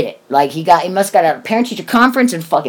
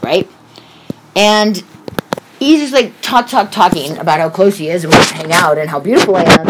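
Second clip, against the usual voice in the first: ordinary talk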